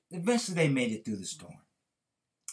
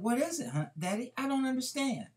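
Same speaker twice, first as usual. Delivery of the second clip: put-on higher girlish voice, confused; young daughter puzzling over her father's lesson; puzzled little girl; 12 semitones higher than usual. A narrator's reading. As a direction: play the small daughter who doesn't get it.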